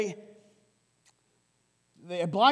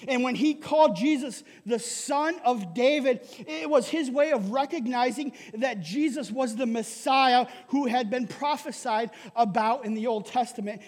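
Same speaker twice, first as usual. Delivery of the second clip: ordinary delivery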